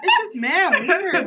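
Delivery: slow words through a laugh. Real, not acted. Laughing while speaking.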